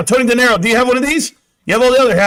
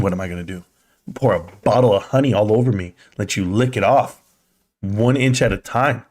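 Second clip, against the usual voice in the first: mock sexy voice; 8 semitones lower than usual. Mock seductive talk to viewer.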